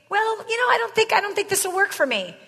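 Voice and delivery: a hint of a hesitant customer; doubtful prospect. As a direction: sound unsure about buying. Salesman voices a doubtful prospect.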